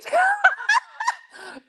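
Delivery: squealing cackle; high shrieking laugh. Real, not acted. Cracking up at Polly, high shrieking laugh.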